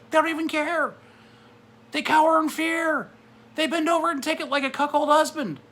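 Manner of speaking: high, loud, rising fury; God's angry rant. Retold or imitated. God denouncing cowardly pastors, high and loud in anger. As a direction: preach it as an outraged God. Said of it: "he's just like".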